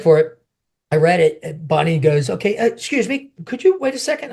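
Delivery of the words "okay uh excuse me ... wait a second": lightly voiced higher-pitched woman; polite casting director. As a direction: imitate a politely interrupting official, pitched up. Retold casting director's polite request.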